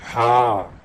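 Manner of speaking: हलक के बीच से हा; mid-throat ha. Shown as a demonstration. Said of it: "हलक के बीच वाले हिस्से से".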